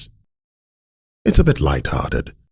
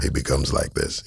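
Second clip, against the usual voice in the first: deeper creaky fry; vocal fry; 6 dB quieter than usual. Shown as a demonstration. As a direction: sink low into a creaky fry. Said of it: "the moment I go deeper".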